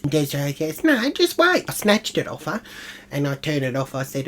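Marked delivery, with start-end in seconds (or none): 0.8-1.6 high whiny daughter; mock whiny teen. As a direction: whine like a teen on an iPad. Daughter Desiree whining.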